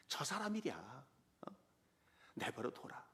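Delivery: soft unvoiced whisper; whispering to calm Abishai; whispered aside. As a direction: hush it, barely breathing the words. David quietly tells Abishai.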